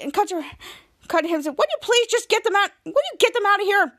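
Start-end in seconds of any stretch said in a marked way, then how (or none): 1.6-3.9 high-pitched impatient pleading; acted exasperation. Retelling a plea to get them out, mock exasperated. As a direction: demand it like someone fed up.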